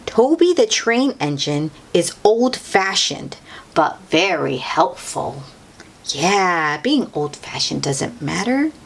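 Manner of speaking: ordinary delivery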